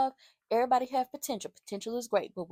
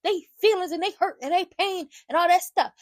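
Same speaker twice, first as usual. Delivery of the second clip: loud, high, fast wrestling promo; retelling Shiloh's promo as a loud, high wrestling-style rant; fired-up promo; 9 semitones higher, 8 dB louder than usual. Retold or imitated. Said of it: "he really laying into it".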